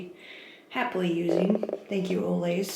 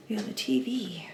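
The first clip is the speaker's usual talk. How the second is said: whispering; 8 dB quieter than usual. Real, not acted. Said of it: "really quiet".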